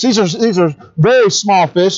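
ordinary talk, nothing marked

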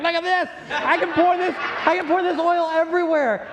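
excited widow filming her endless oil; showing off. Acted. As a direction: brag to the followers, all delight. Posting the miracle on Instagram.

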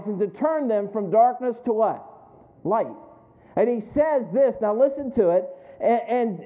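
high, loud scripture proclamation; impassioned preaching. Real, not acted. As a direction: proclaim the verse with force to the congregation. Preaches scripture at full force.

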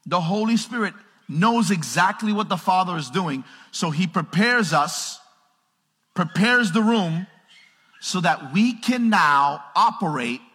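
loud, high, slow scripture proclamation; full-force preaching. Real, not acted. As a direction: drive each phrase home to the congregation.

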